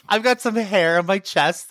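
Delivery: corrected through giggles; laughing. Real, not acted. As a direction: laugh while fixing the line. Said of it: "Chest on your hair".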